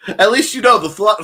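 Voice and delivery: laughing through the words. Real, not acted. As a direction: crack up mid-sentence. Laughing at own joke.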